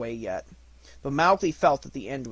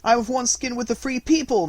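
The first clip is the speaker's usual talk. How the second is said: high, loud protest; boy crying out to the dying wolf; 10 semitones higher, 10 dB louder than usual. A narrator's reading. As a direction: cry out against being called a man. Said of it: "Mowgli cried".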